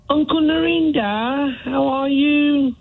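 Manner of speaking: high, drawn-out mock Indian accent. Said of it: "not in that accent".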